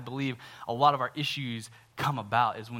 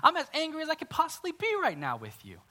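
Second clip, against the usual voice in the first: much higher, strained mock outrage; mock angry Jonah; 13 semitones higher than usual. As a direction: squeal it like a sulking prophet. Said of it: "He has the audacity to say".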